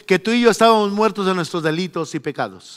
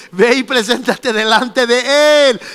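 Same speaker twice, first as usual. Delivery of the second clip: usual speaking voice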